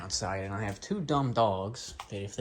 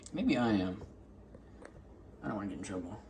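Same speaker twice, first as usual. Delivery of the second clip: ordinary delivery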